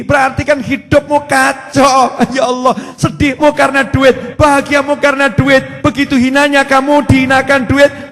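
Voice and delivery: loud, driving sermon delivery, rising to a peak; full-force preaching. Acted. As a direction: hammer each point home to the congregation.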